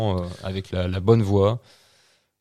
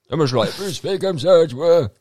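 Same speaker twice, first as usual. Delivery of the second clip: put-on higher, strained old man demo; 8 semitones higher, 4 dB louder than usual. Shown as a demonstration. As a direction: show how a very old man should sound. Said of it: "Avec une voix de vieux".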